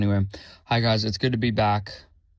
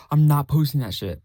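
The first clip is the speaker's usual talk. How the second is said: loud; 3 semitones higher, 6 dB louder than usual. Retold or imitated. Rejecting his own bad episode.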